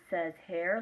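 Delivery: usual speaking voice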